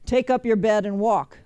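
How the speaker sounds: retold command. Preacher quoting Jesus to the lame man, loud firm command. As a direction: give the order out loud, firmly. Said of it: "He told the lame man".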